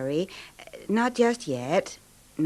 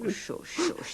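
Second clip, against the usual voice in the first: soft unvoiced hushing; soothing shush; 8 semitones higher, 10 dB quieter than usual. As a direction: hush him gently back to rest. Wife soothing sick husband.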